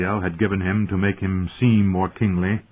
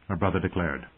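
usual speaking voice

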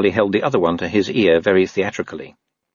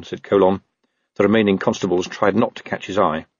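ordinary delivery

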